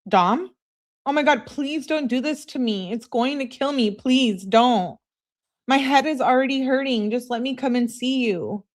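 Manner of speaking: acted high-pitched begging; pleading. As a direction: plead with a boyfriend who won't answer. Acts out Kenzie's pleading texts.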